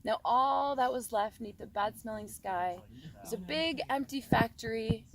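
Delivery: ordinary delivery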